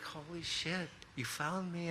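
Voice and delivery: high, soft astonished voice; hushed amazement. Retold or imitated. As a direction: gasp it quietly, mimicking a stunned girlfriend. Girlfriend amazed at being found.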